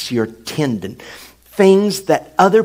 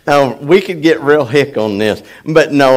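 normal talking voice

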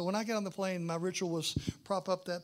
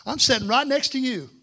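loud, higher mock-booming declaration; big passenger's announcement; 7 semitones higher, 11 dB louder than usual. Big man claiming the seat beside preacher, loud and emphatic. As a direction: announce it loudly to a stranger.

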